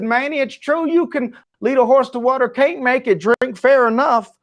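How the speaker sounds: imitating a cowboy, high-pitched East Texan accent. Cowboy at the back cutting off the speaker, East Texan accent. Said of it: "rich East Texan accent".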